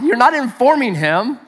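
ordinary talk

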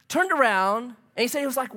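ordinary talk, nothing marked

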